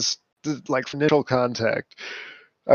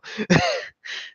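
high laugh. Real, not acted. Amused laugh at own story.

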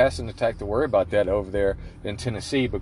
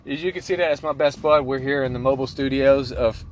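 ordinary talk

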